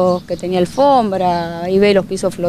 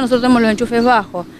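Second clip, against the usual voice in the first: ordinary delivery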